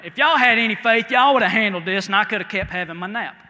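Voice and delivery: put-on loud, teasing scold to the boys; scolding Jesus. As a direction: chide them half joking, loud. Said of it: "He tells the boys".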